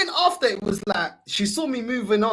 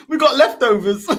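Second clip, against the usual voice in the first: louder line burst out through laughter; real laughing; 3 semitones higher, 7 dB louder than usual. Real, not acted. Friend topping the morning-after joke, laughing as he speaks. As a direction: crack up while adding the joke.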